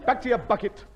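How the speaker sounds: shouting high and loud to chase off a cow; shooing shout. Acted. Tony chasing the cow off with a shout. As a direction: bellow it out, driving the beast away.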